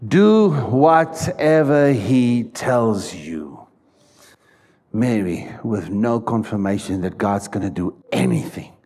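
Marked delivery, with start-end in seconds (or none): 0.1-3.7 slow, deliberate, every word stretched; slow drawn-out quote. Shown as a demonstration. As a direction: say it slowly together with the congregation. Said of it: "let's say that once".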